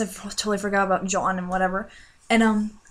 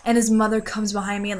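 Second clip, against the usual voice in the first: ordinary delivery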